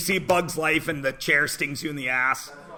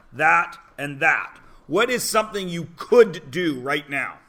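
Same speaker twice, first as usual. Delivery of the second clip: ordinary talk, nothing marked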